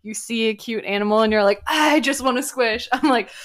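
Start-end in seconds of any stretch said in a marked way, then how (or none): 1.7-2.9 overwhelmed by cuteness. Retold or imitated. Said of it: "cute aggression thing".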